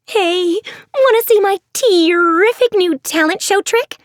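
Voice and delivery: a showoff bursting to share; zany Maddie. Acted. Maddie to her friends, giddy and showy.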